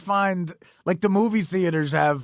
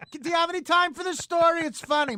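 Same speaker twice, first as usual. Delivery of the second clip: much higher chatty put-on voice; mock storyteller voice; 10 semitones higher than usual. Woman pitching her funny story, mock high voice. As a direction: keep the oversharer's high pitch. Same mimicked storyteller continues.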